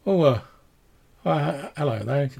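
woman caught off guard; timid village woman. Acted. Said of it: "the woman sort of turns around and she says".

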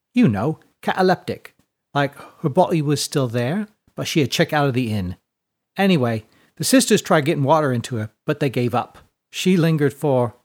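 put-on casual, chatty girl's character voice; perky orphan girl. Tess explains catty to Fiona, perky and matter-of-fact. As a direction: explain it offhand, like a streetwise orphan.

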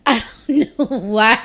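said through real laughter; laughing. Real, not acted. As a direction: laugh while you repeat it.

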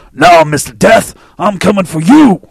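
loud, drawn-out imitation of Mike D. Podcast host imitating his friend Mike D's voice. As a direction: growl it out like your buddy Mike D. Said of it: "I gotta say it in my D voice".

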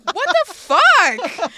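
high, loud, drawn-out What the fuck; Spike outraged. Retold or imitated. Spike baffled at Buffy's rejection, slow high outraged whine. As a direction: stretch it out in disbelief. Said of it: "And it's like".

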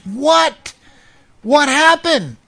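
high, startled questions; what on earth, high and urgent; alarmed. Retold or imitated. Counselor asking the crying man what happened, alarmed.

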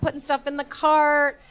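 ordinary delivery

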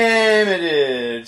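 slow, loud drawn-out mockery. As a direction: stretch it out mockingly. Mocking Russ nickname.

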